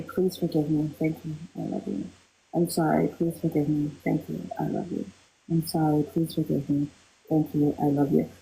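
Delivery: a soft meditative chant of forgiveness; soft mantra recitation. Shown as a demonstration. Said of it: "Can we do a round of Ho'oponopono".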